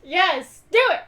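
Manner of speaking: loud, high, eager shout; excited cheer. Real, not acted. Player cheers the bell-ringing plan, loud and thrilled. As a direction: jump at the idea, thrilled.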